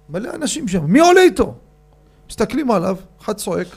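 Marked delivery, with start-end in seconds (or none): 0.8-1.5 higher and louder pleading call; urgent shout. Retold or imitated. Repeated call for someone to ride along, louder. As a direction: shout it again, more urgent.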